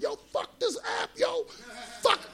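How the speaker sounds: high-pitched outraged imitation; mock mad yelling. Imitating Uber drivers in the office, mad shouting. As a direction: shout like a fed-up delivery rider. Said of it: "fucking just mad".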